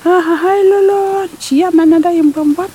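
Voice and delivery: rire moqueur plus haut et plus fort; le rat saute en ricanant, aigu et fort. Acted. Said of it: "en lâchant le même rire". (le rat se moque du poulpe, rire aigu et fort)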